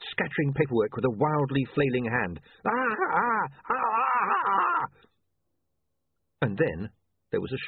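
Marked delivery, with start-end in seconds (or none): 2.6-4.9 high choking cries, rising to a scream; staggered screaming cries. Acted. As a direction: gasp and wail as the flash hits. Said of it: "Otto screamed".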